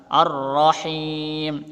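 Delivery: Ar-Rahim with the rr pressed hard and held; slow Ar-Rahim, press the tasydid. Shown as a demonstration. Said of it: "Maka harus ditekan".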